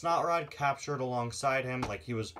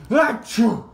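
loud sneeze; 8 semitones higher, 11 dB louder than usual. Acted. Snotrod sneezes loudly.

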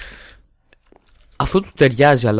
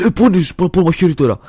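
higher, louder retold excitement; excited; 7 semitones higher, 8 dB louder than usual. Gamer retells his excited reaction to a cheap knife. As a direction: blurt it out like you just spotted a bargain. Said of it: "είμαι σε φάση".

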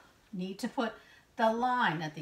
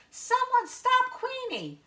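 high-pitched cry for help; Quincy; 4 dB louder than usual. A narrator's reading. Quail calling for help to stop the dog, high cry. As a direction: call out urgently in a very high storybook voice. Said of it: "cries Quincy".

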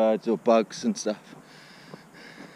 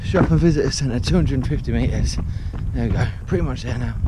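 loud, breathy talk mid-run; puffing out words on the move; while running; 2 semitones higher, 7 dB louder than usual. Real, not acted. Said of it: "I've run up here".

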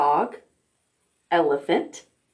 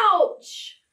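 high, breathy pretend ouch; mock ouch; 10 semitones higher than usual. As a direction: fake a little hurt for fun. Mock pain after bump.

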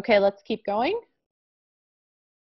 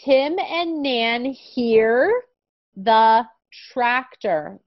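very slow, clear reading; slow reading aloud; 2 semitones higher, much slower than usual. Shown as a demonstration. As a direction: model the sentence word by word. Models the sentence slowly.

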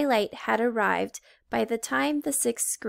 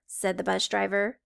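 ordinary delivery

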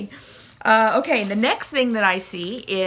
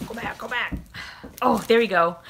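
normal talking voice

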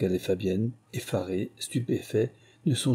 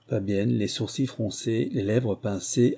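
ordinary delivery

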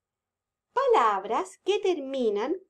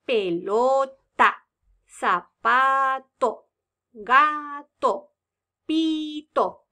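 put-on slow, stretched syllables for kids; 5 dB louder, much slower than usual. Shown as a demonstration. Teacher to children, sounding out slowly. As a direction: draw each syllable out slowly. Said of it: "teacher sounds out words".

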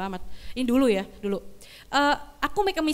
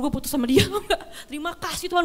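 laughing; 5 semitones higher than usual. Real, not acted. Laughing relief over breakup.

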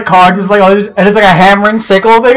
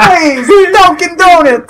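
high, slow mock-Boston plea; drawn-out Boston whine, pitched way up; Massachusetts accent; 10 semitones higher, slower than usual. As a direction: beg in a hammed-up Boston accent. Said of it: "we're from Mass".

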